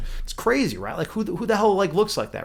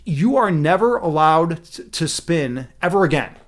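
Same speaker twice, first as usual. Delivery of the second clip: slow, firm decree; putting foot down; slower than usual. Acted. Prince forbidding his new wife to spin, firm and slow. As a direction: lay down the law, word by word. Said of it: "I'm putting my foot down".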